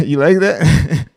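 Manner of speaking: loud, slow question through laughter; laughing. Real, not acted. Streamer tickled that viewer liked the accent, laughing. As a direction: ask it with a pleased laugh.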